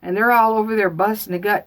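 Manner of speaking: usual speaking voice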